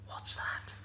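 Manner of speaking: whispered, startled hush; hushed suspense. A narrator's reading. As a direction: whisper as if listening out. Ears pricked up at a sound.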